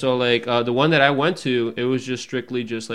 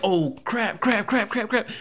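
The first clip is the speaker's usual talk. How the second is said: panicked; 10 semitones higher, slower than usual. Retold or imitated. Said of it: "I just started freak".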